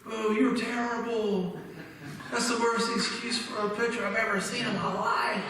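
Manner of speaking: high mock jeer from the stands; heckling friend. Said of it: "He's going".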